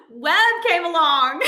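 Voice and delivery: laughing through the words. Real, not acted. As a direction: laugh while announcing. Laughing reveal of baby.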